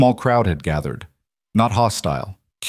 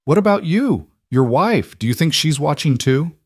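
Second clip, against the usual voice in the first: put-on high, curious little girl; a light child voice asking gently; young girl voice; 7 semitones higher than usual. A narrator's reading. Child Juniper asks Caleb.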